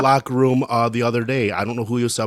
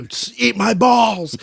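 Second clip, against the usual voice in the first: higher and very slow, stretched insult; drawn-out mock yell; 10 semitones higher, slower than usual. Shouting a prank into a teammate's voice text, drawn-out. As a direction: holler it long for the mic to catch. Yelling into friend's talk-to-text.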